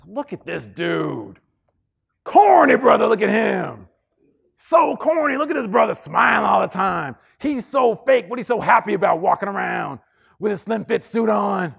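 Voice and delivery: loud, high mocking jeer; mocking bully. Acting out mocking hallway critics.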